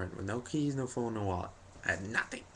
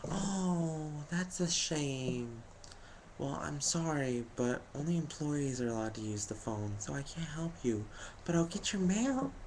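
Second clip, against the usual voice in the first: put-on higher, slow, sugary old lady apology; much higher, polite old lady, slow at the start; sweet old lady; 7 semitones higher than usual. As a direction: let him down as gently as a grandma. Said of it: "she's just like".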